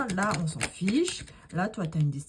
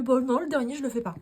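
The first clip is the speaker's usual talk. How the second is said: higher, louder voiced reluctance; mock hesitant client; 8 semitones higher, 5 dB louder, faster than usual. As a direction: play the doubter backing out. (la cliente qui hésite, plus aiguë)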